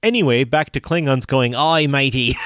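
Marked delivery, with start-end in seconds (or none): 1.6-2.3 louder hammed-up bad Australian accent; a Klingon with a bad Australian accent; mock Australian pirate greeting, Australian accent; terrible Australian accent. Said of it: "apologies for the terrible Australian accent".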